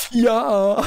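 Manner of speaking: very high, loud cry of joy; triumphant shout. Real, not acted. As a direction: shout the win out. Scores a killstreak.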